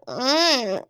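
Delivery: put-on slow whiny baby voice. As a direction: whine it like a big baby. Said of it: "a really whiny voice".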